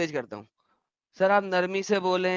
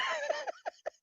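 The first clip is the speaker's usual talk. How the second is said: exasperated laugh; 16 dB quieter than usual. Real, not acted. Laughs after rant.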